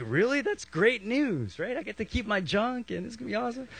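high, gleeful disbelief; acted delighted relief. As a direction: play someone thrilled at unexpected good news. Acted converts relieved at the news.

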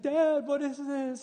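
put-on high, slow child voice; a young kid's shocked high voice; alarmed little son. Son voiced in funny story.

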